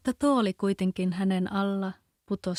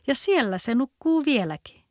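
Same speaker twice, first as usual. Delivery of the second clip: little bear cries out, higher; shouting; 5 semitones higher, 4 dB louder than usual. A narrator's reading. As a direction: call it out in alarm. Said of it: "huusi karhu pikkuinen". (pieni karhu huutaa, korkeammalla)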